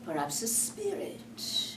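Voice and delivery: slow, hushed fear; spooked whisper. Acted. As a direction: breathe it nervously. Hook whispers fearfully.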